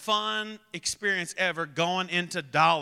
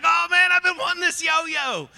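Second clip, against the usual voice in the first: high, loud, excited imitation of a boy; thrilled child; 11 semitones higher, 5 dB louder than usual. Son opening a yo-yo from his brother, thrilled. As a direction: squeal it like a kid with a new toy.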